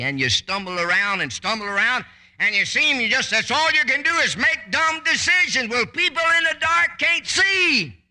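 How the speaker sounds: rising from firm to high, loud preaching; building to shouting preach. Acted. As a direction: climb into a full-voiced preacher's cry. Preaching builds to full force.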